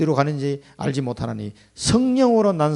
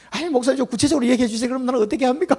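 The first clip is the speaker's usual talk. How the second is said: mock exasperated demand; 9 semitones higher, faster than usual. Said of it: "막 화를 내고 나왔대".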